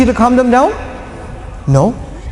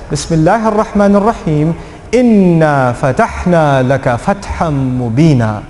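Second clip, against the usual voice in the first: Quran recitation; 4 semitones lower than usual. Acted. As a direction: recite the verse with reverence. Recites the ayah.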